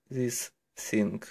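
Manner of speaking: ordinary talk, nothing marked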